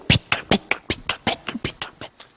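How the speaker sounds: a comic squeaky zigzag; mock crooked furrow. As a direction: squeak it out as if wobbling.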